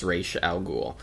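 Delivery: Ra's al Ghul said as "Raysh al Gool"; creator's pronunciation "Raysh". Shown as a demonstration. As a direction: say Ra's with long ay and final sh. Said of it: "he says it's pronounced".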